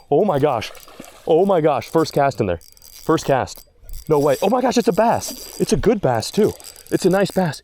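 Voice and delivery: loud, high burst of disbelief; thrilled, high-pitched outburst; excited. Real, not acted. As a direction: exclaim in real amazement as the fish hits. Hooks a bass on first cast.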